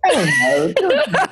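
high, slow laugh through words; laughing it off in disbelief. Real, not acted. Laughing after the bluff.

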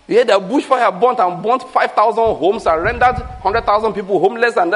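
ordinary talk

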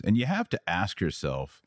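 ordinary talk

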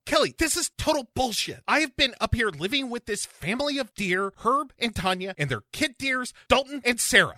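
high squeaky put-on aggrieved naturalist; silly high complaining voice; Scotty voice. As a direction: whine it high, put upon. Voicing Scotty in bit.

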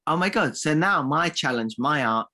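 normal talking voice

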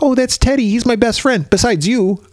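put-on high, sweet child voice; Judy introducing her teddy to Ralph, in a high sweet voice; sweet little girl voice.

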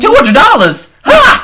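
high, loud mock whoop over a paycheck; hammed-up glee, pitch shooting way up; mock excited cheer. Friend mocking her shock at a small paycheck, loud excited cheer.

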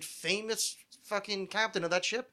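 usual speaking voice

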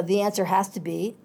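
ordinary delivery

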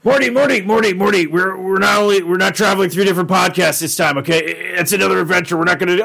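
hammed-up adventurer scolding his sidekick; Rick. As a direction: talk over the kid in a put-on, insistent voice. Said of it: "jeez, Rick, come on".

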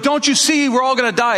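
imitating alarmed disciples; retold panic. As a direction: plead as the frightened disciples, half acted. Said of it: "the disciples are freaking out".

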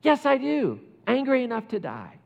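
Jonah's angry reply. Retold or imitated. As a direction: insist on your right like a stubborn sulking prophet. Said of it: "And Jonah answers again".

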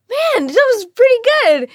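high, loud mock praise; impressed director. Director praising her read, high loud impressed voice. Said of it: "He's like".

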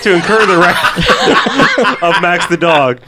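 slow, laughing mock-grand question. Real, not acted. Friend asking about the dog's revenge, laughing slowly. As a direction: chuckle through the dramatic phrase.

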